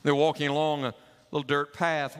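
ordinary talk

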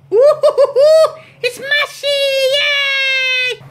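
very high squealing hoot and cheer; silly falsetto cheer. Pretend. Dad squealing high to amuse the kids mashing.

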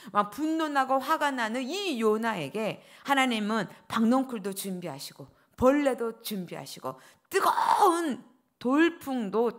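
usual speaking voice

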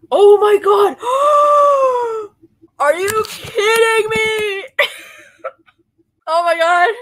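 high, loud shriek of overwhelmed delight; fan screaming. Real, not acted. [name] fan at home reacting to the Eras concert, screaming with teary joy. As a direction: scream like the concert just broke you.

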